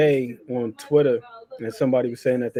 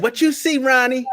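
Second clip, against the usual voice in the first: lightly voiced quote, pitched much higher; imitating Senior; 10 semitones higher than usual. Father questioning the trainer in the corner, high-pitched imitation. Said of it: "Senior's like".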